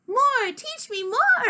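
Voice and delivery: high, drawn-out plea; keen kid clamouring; eager begging. A narrator's reading. Child begs for pirate lessons.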